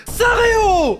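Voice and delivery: shout. Real, not acted. Excited game opening yell.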